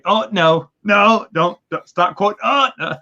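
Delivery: rising, pleading "oh no, don't"; begging the book not to go on; mock dismay. Reader pleading with the book, in rising mock dismay. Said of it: "you're like".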